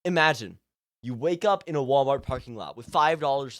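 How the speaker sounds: usual speaking voice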